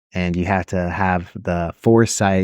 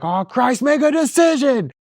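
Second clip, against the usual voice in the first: much higher, exasperated mock plea; slower than usual. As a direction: beg him to decide, fed up. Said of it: "I was like".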